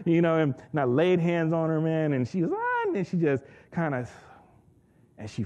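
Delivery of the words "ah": put-on girl's cry. Girl cries out and falls.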